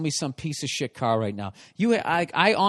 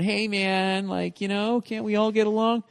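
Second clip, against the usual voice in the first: laid-back hippie; 4 semitones higher than usual. Retold or imitated. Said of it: "just some hippie walking around".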